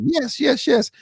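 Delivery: high-pitched gleeful yeses; rapid excited cheer up high; retold cheering. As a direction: celebrate in a high squeal. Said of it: "I was over here like".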